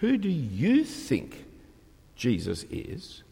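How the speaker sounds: slow, pointed personal question; stress on you. Shown as a demonstration. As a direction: put them on the spot, slowly. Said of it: "I'm asking you about you".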